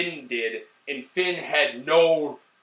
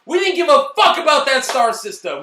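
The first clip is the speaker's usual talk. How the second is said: loud rant; 6 semitones higher, 6 dB louder than usual. Real, not acted. Ranting about the sequel.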